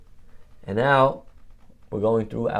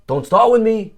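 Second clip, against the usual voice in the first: loud, higher mock angry yell; hammed-up angry neighbor shouting; 8 semitones higher, 6 dB louder than usual. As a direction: retell the neighbor's loud outburst. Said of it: "The guy starts yelling".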